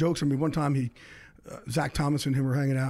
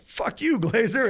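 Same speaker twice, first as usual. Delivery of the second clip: retold angry comeback; 7 semitones higher, slower than usual. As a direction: fire it back like a heated bar argument. Retold shouting match.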